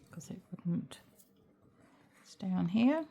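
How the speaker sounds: talking around a paintbrush in the mouth. Real, not acted. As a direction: speak with a brush held in the teeth. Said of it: "Put the paintbrush in my mouth".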